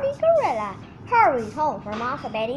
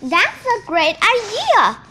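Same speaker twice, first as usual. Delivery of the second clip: delighted; 6 semitones higher, 6 dB louder than usual. A narrator's reading. Gorilla delighted by suggestion.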